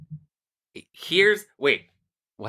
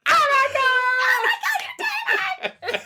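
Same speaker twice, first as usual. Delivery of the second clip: excited squeal. Retold or imitated. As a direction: squeal it out like big news. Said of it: "you're like".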